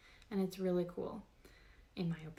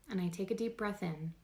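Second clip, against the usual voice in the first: guided meditation; 5 dB quieter than usual. Real, not acted. Host guiding listeners through a body scan, calm meditation voice. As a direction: talk them gently through the body, unhurried.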